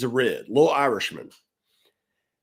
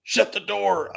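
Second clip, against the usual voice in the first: a high-pitched, sharp command from the angry boss; mock the boss barking the order; 7 semitones higher, slower than usual. Angry boss orders the young director to shut the door, high and sharp. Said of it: "he's yelling and screaming".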